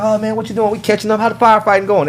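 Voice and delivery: a hint of chatty relatives; proud family. Family voiced at party.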